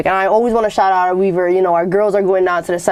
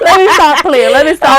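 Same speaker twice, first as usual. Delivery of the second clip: laughing; 7 semitones higher, 7 dB louder than usual. Real, not acted. Laughing, trying to stop joking.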